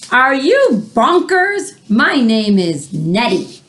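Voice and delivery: hammed-up furious monster; outraged monster. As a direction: roar the question at the boy. Monster Nettie scolding George.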